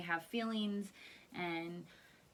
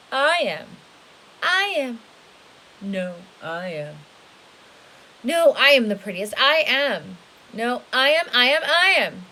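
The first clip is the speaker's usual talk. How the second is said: loud competing cries, voices piling up; a crowd of cats all claiming the prize; cats crying out; 4 semitones higher, 19 dB louder than usual. Acted. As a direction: shout over each other like squabbling cats. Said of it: "cried hundreds of cats".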